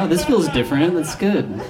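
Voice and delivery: put-on a kid gasping at a new feeling; kid's delight. Kid on the controller, amazed and delighted. Kid discovering rumble pack.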